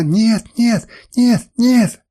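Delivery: high, loud string of cries; unrelenting protest. A narrator's reading. Woman keeps protesting to her master, desperate cries. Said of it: "не унималась она".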